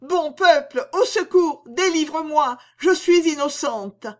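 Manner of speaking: high, loud desperate pleading cry; heart-rending cry. A narrator's reading. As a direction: beg the crowd for your life. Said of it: "D'une voix déchirante, elle criait".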